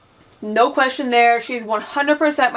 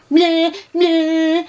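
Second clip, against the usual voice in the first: mocking meh; 6 semitones higher, 3 dB louder than usual. Mocking Kiera's complaint.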